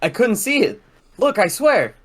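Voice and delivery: loud, urgent self-defense; defensive pleading. Acted. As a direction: plead to be believed, voice climbing. Ray pleads his innocence.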